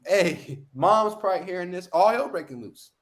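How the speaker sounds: ordinary delivery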